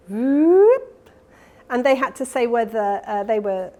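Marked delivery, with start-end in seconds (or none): 0.1-1.1 a loud whoop that rises quickly to high; sharp rising whoop. Shown as a demonstration. Lecturer demos a sharp sound rise: loud rising whoop. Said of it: "a strong rise time".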